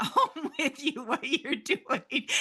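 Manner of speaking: words breaking up into real laughter; laughing. Real, not acted. Speaker warning listeners to tell housemates, laughing. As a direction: laugh through the rest of the sentence.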